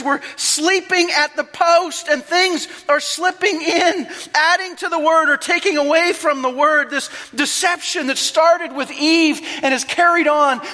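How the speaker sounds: high, loud urgent sermon climax; impassioned preaching. Acted. Pastor urges believers to stay on guard, preaching at full force. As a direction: plead with the church to wake up.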